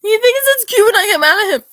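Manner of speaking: loud, high-pitched frustrated yell; exasperated outburst. Real, not acted. Telling viewers about her crush, exasperated shout. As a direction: blow up in disbelief at him.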